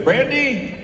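calling wardrobe. Retold or imitated. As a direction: shout across set for wardrobe. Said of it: "On set, I go".

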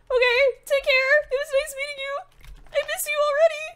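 very high, plaintive voice; mock-sad farewell. Player parting from Winslow, high plaintive farewell. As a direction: squeak out a sorrowful goodbye.

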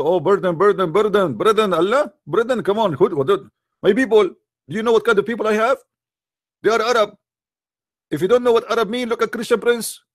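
high, insistent put-on whine; mock pleading prophet. Preacher parodying a prophet begging Allah, high whine.

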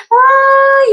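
loud, very high drawn-out "oh"; high squeal. Real, not acted. Reacting to a viewer's chat message, high loud squeal. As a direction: squeal it out after laughing.

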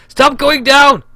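loud, high frustrated yell; shouty voice. Real, not acted. Gamer ordering the plane to stop sinking, frustrated shout. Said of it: "my shouty voice".

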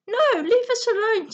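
high-pitched indignant snap; snapped retort. A narrator's reading. Girl to bullies after her bird, snapping. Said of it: "snapped Sabella".

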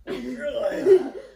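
high, giggly burst of laughter; real helpless giggle; laughing. Real, not acted. Partners cracking up before the lift, high giggle. Giggling fit during acrobatic stunt attempt.

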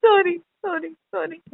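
high, pleading apology; desperate high-pitched sorry; frantic high sorry. Acted. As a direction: squeak out sorry in a panic.

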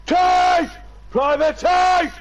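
high, loud yelling of a name; calling out. Pretend. Friend calling Private Tate into the game, hammed-up shout. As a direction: bellow for Private Tate across the room.

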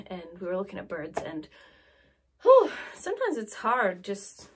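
normal talking voice

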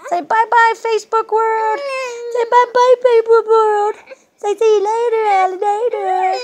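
put-on high, loud singsong bye-bye to the baby; grandma's loud high singsong farewell; singsong goodbye. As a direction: sing out goodbye to the online audience. Signing off to Facebook viewers.

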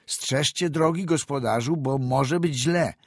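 doctor warning the driver. A narrator's reading. Doctor calls to the driver over the wind, loud warning. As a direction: warn the driver loudly against the storm. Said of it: "zauważył doktor".

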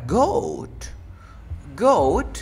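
goat called out loud and high; new word announced. Shown as a demonstration. Teacher announcing the new slang word goat, loud and high. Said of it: "Siguiente palabra".

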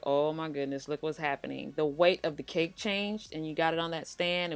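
ordinary talk

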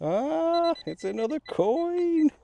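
real high, loud whoop of delight; excited discovery. Real, not acted. Detectorist finds another coin in the new field, excited. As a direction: cry it out high, drawing out the words.

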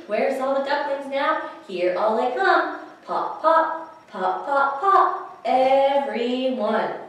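lively storytime read-aloud; animated picture-book reading; reading to children. Acted. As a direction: read it like storytime for little ones.

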